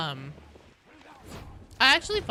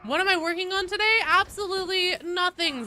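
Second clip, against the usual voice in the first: playful announcement; 10 semitones higher, 6 dB louder than usual. Acted. Streamer jokes to chat.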